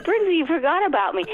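high-pitched mock complaint at being forgotten; retold indignant protest. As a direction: call out, reenacting the complaint. Retells calling out to stage manager.

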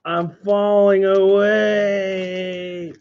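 slow, drawn-out, fading voice; mock fading away. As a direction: drift off dramatically into the void. Mock falling into vortex.

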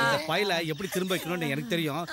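ordinary talk, nothing marked

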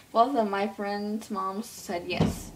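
slow, deliberate correct phrasing; slowed-down corrected version; careful correction. Pretend. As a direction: say it right, slowly and clearly. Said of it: "both my mom's friends".

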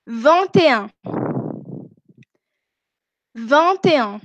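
each French number from "Vingt" to "Vingt-neuf" said singly, long pauses between; model pronunciation. Shown as a demonstration. Teacher has students listen to French numbers, model pronunciation. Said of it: "además de escuchar mi pronunciación".

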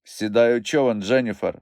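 usual speaking voice

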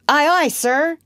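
high, loud echo of the order; obedient sailor's reply. Acted. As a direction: answer the captain like a keen recruit. John obediently repeats Peter's phrase.

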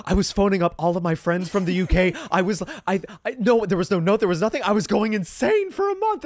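high, loud, exasperated retelling; frantic outburst. Acted. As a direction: rant like someone driven mad by a mystery. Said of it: "And she was like".

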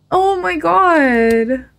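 high, slow, stretched-out oh my God; drawn-out mock dismay. Reacting to sad game messages.

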